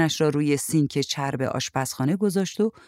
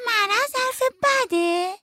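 put-on very high, drawn-out little-kid voice; a small child calling a question from the next room; slower than usual. A narrator's reading. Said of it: "آتی داد زد". (صدای خیلی نازک و کشیدهٔ بچه)

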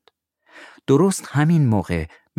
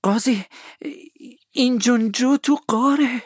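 put-on much higher, urgent boy; Tom's alarmed cry. Tom tells the judge Injun Joe is inside, alarmed. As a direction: blurt it out high and scared.

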